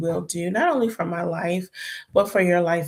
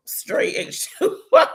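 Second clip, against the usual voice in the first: very high, drawn-out, louder repeat; playful high squeal; 7 dB louder than usual. Retold or imitated. Repeating her answer to the cashier for laughs, playful high squeal. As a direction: squeal it slowly, on the edge of laughter.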